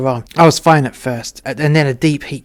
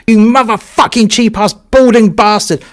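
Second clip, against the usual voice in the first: much higher and louder, top-of-the-lungs rage; furious shout; 8 semitones higher, 8 dB louder than usual. Retold or imitated. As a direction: scream it at the cabin walls. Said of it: "shouted at the top of my lungs".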